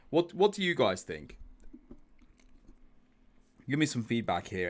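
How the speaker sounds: ordinary delivery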